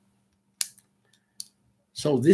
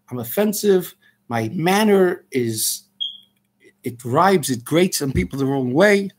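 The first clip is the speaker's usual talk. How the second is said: usual speaking voice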